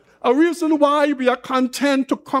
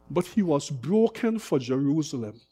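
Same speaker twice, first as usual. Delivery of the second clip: broken, hushed; 8 semitones lower, 7 dB quieter than usual. Real, not acted. Preacher moved, voice drops.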